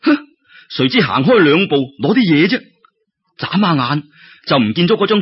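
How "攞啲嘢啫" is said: ordinary delivery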